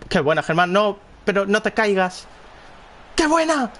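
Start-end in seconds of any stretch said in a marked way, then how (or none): 3.1-3.7 excited shout. Real, not acted. Gamer shouting praise at a pass, excited. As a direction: yell it at the screen. Cheering a good play.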